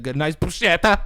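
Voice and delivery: loud Italian flourish on bruschetta; showy Italian bruschetta. Acted. Mocking a TV cook, showy Italian pronunciation. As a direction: punch out bruschetta with an exaggerated Italian accent. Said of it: "hear fucking Giada De Laurentiis say it".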